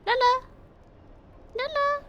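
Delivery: short, high, sing-out call; coaxing pet call. Real, not acted. Owner calls the hidden cat, high and coaxing.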